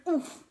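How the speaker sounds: loud, high winded oof; pained oof grunt. A narrator's reading. Narrator grunting as his swagger fails. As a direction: let out a hurt grunt mid-swagger.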